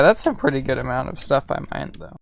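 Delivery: ordinary talk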